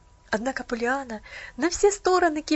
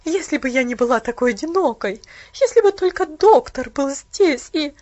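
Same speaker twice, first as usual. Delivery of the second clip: distraught; 10 dB louder than usual. Acted. Aunt close to breaking.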